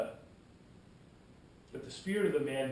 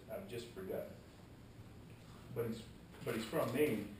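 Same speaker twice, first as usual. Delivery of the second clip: ordinary delivery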